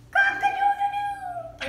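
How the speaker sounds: high loud rooster cock-a-doodle-doo; rooster crow. Pretend.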